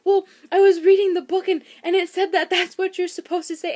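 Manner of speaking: high, loud imitation of a flustered husband; mock apologetic excuse; retold remorseful defence. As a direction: protest your innocence, hammed up. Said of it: "he felt so bad".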